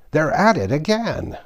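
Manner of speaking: high-pitched, half-volume narrated shout. A narrator's reading. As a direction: call it out high, without full force. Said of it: "shouted at the top of his voice".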